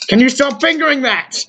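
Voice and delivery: high exasperated complaint. Real, not acted. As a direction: whine it, fed up. Exasperated at friend.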